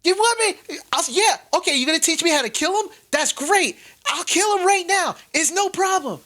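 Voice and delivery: put-on high, frantic kid voice; panicky halfling kid. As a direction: squeak it out like a scared, cocky boy.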